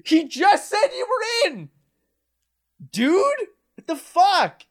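high-pitched, drawn-out disbelief; incredulous outburst. Real, not acted. Co-host reacting to someone being let in, incredulous outburst. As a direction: cry out in disbelief, pitch leaping up.

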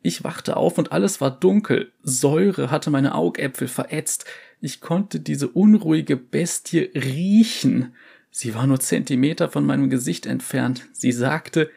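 high, haunted survivor voice; traumatized survivor. Acted. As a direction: relive the horror, shaken. Survivor recounts attack.

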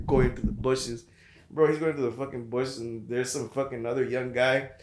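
ordinary delivery

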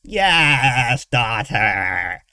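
stretched-out, gratified creature voice; pleased villain. A narrator's reading. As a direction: savour it as the pleased captor. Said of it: "Her captor seemed pleased".

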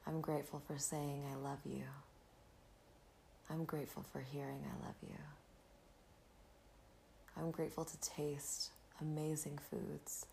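breathy calm, guiding the listener inward; soft spa whisper. Acted. Leads a gratitude meditation.

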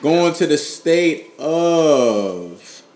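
very slow, stretched-out words; stalling announcement. Real, not acted. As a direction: drag out the last word while waiting. Stalls before announcing next state.

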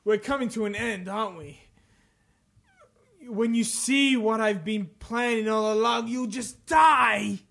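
measured menace building to the last word; theatrical villain quote; dramatic villain reading. Acted. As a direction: build to a drawn-out threat. Reads game villain quotes aloud.

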